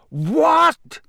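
high, outraged "What?"; acted cry of indignant fury; furious bellow. A narrator's reading. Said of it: "Ron bellowed furiously".

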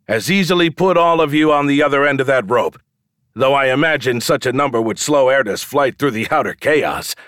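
high, projected showman's pitch; calling to the crowd. A narrator's reading. Showman asks for two men at the rope, calling out. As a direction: pitch the challenge to everyone watching.

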